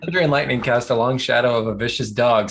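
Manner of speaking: usual speaking voice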